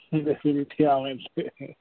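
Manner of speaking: amused, laughing agreement. Real, not acted. Co-host agreeing with the host's joke about fans, laughing. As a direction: say it slowly through a laugh.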